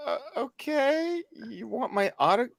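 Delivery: high, soft, drawn-out disbelief; mock-bewildered, quietly questioning; retold bafflement. Retelling baffled reply to autograph request.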